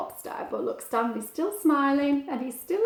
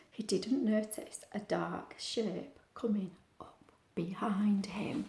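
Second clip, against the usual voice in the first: whisper; 2 semitones lower, 9 dB quieter than usual. A narrator's reading. Suspense before the shark.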